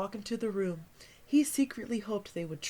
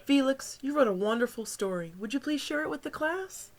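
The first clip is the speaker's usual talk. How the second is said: praising teacher; 5 semitones higher, 4 dB louder than usual. A narrator's reading.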